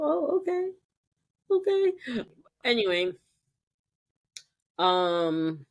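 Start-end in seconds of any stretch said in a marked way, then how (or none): 0.0-2.2 high surprised okay. Real, not acted. Surprised relationship still ongoing.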